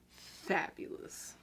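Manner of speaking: usual speaking voice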